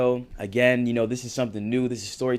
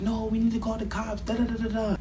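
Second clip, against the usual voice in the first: high, put-on voice brushing him off; insistent Rochelle; 12 semitones higher than usual. Rochelle insisting to the friends on calling the cops, high mocking voice. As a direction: mimic her insisting, pitched up.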